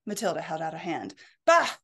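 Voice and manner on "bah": dismissive bah. Acted. Dismissive old lady.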